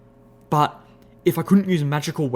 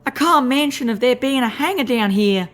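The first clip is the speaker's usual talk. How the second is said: high squeaky drawling critter; tiny woodland creature talking tough; 10 semitones higher, 3 dB louder than usual. Acted. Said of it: "he added squeakily".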